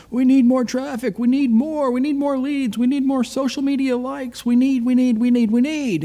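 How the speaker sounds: mimicking a demanding boss, high and insistent; pestering boss. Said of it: "The dealer's all over me about".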